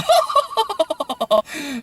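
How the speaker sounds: high-pitched fake laughing; hammed-up parody cackle; mock laugh. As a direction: put on a long, high, silly laugh.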